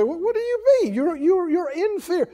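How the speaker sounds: high-pitched challenge from a doubter; accusing onlooker. Acted. People challenging a frightened believer, accusing and high. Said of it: "some people say".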